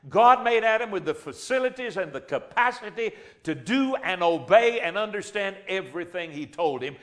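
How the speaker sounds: ordinary delivery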